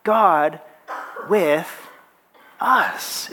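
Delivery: "God", "with", "us" each alone with a pause between; word by word. Shown as a demonstration. Preacher summing up the series title, one word at a time. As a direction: set each word apart, raising "us". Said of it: "emphasis on each of the different words".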